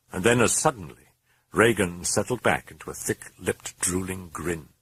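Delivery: usual speaking voice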